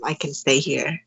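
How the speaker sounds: ordinary delivery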